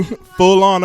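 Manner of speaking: ordinary talk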